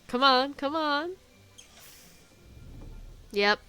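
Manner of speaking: ordinary talk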